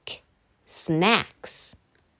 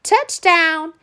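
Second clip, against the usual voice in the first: high, louder cheer; shouted; 7 semitones higher, 4 dB louder than usual. Acted. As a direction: shout the score happily. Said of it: "shouted Danny".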